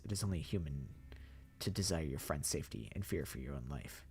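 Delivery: low, soft, courtly voice; polite zombie mastermind. Acted. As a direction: menace under good manners. The Professor explains.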